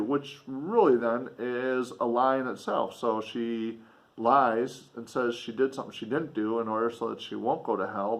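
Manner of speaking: plain reading voice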